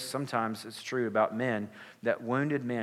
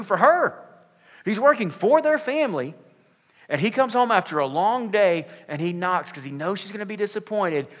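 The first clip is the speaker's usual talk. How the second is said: preaching much louder and higher; emphatic preaching; 9 semitones higher, 9 dB louder than usual. Real, not acted. Pastor to congregation, defending the hardworking husband, loud and high. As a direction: drive the point home to the congregation.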